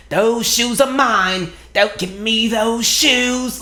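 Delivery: put-on slow, high, loud child's demand; Bad Seed little girl. Little girl fighting the man for the shoes, high drawn-out demand. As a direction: drag out each word in a high, insistent little-girl voice. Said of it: "I'm even hearing that little girl from the movie The Bad Seed".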